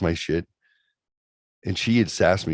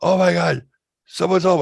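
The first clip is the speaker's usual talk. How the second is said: panicked; 10 semitones higher, 6 dB louder than usual. Retold or imitated. Girlfriend panics, mom is home.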